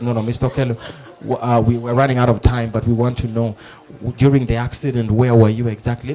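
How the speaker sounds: patient reporter. Retold or imitated. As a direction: press politely in a low, restrained voice. Reporter reins in the rambling witness.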